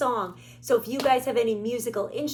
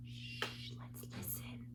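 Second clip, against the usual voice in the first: whisper; 12 semitones lower, 21 dB quieter, slower than usual. Acted. Hushing the children to listen.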